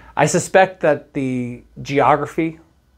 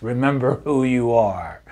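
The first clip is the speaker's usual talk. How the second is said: lightly voiced solemn Mufasa; a touch of grave lion-king father; James Earl Jones impression. Dad quoting Mufasa to teens heading out, light solemn impression. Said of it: "in my best James Earl Jones voice".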